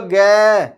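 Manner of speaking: गै with its end vowel held long; drawn-out syllable. Shown as a demonstration. Teacher asking pupils to repeat, गै drawn out. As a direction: stretch गै so the vowel sound is clear. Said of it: "बोल के देखो".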